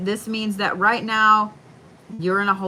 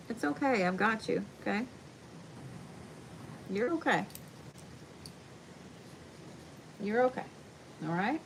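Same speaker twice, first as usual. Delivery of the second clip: soft pet talk; 3 semitones higher, 14 dB quieter, faster than usual. Real, not acted. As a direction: coo to a scared dog. Comforting dog, soft high voice.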